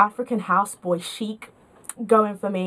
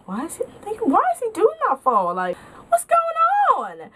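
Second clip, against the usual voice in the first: high-pitched bewildered squeal, rising to the end; high, incredulous inner monologue, acted out; baffled inner voice; 12 semitones higher than usual. Acting out baffled inner thought.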